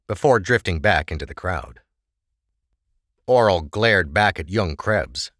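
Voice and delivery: ordinary delivery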